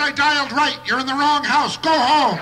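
loud, high retort; shouted comeback; yelling back. Retold or imitated. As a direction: yell it right back at him. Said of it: "yell back".

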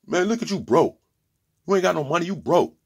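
high, taunting club voice; mock taunt in a club; mocking a clubgoer. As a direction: clown someone for being broke. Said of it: "look at somebody else in the club and say".

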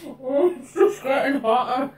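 muffled behind hand; speaking through fingers; hand over mouth. Real, not acted. Seen 1: Left speaker presses hand over mouth throughout the labelled words.

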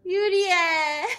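high, loud happy shout of a name, half laughing, half crying; joyful cry. Real, not acted. Overjoyed viewer greeting a returning character, loud and tearful. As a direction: call her name out in tearful delight.

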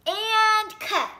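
loud high shout of cut; booming call. A narrator's reading. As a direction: boom it across the studio. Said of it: "a voice boomed".

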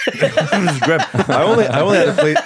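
high, loud words through laughter; laughing. Real, not acted. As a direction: crack up while confessing it. Cracking up at own grim trip.

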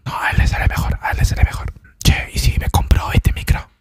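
unvoiced whisper throughout; ASMR whisper. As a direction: whisper chat close to mic.

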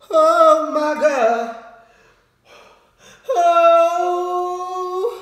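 high drawn-out wail of pain. Real, not acted. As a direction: wail from the burning spice. Burning from spicy noodles.

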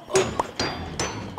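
quiet, sharp intake of breath; sudden voiceless gasp; startled gasp. Real, not acted. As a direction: gasp as if caught off guard. Startled by enemy in game.

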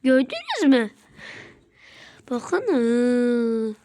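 usual speaking voice

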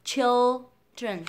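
put-on "children" loud, split as "chil-dren"; correct children. Shown as a demonstration. Teacher reviewing, "children" loud in two syllables. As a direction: stretch "children" loudly, sounding L and DR. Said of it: "Cùng ôn lại bốn từ này".

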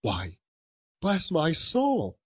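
high, drawn-out exclamation of surprise; surprised cry. A narrator's reading. Nephew startled by an unexpected caller, surprised cry. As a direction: exclaim it high, stretching the words. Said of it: "cried Fred".

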